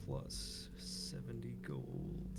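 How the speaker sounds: slow gleeful whisper. Real, not acted. Player savoring the gold reward, slow whisper. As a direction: breathe it out, slow and greedy.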